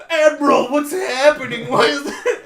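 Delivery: high, loud mock panic; hammed-up panic from the crew; panicked officers. Panicked officers in retold plot.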